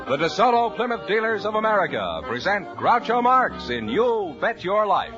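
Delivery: normal talking voice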